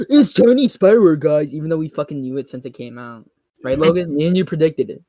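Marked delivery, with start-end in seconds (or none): 0.1-1.5 mocking announcement. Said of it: "They're like".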